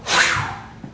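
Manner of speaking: airy, unvoiced whoosh; puff of wind. Preacher imitating the wind in his story, a puff. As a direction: blow out a quick gust like wind. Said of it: "the puff of a wind".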